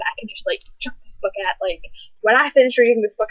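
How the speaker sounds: normal talking voice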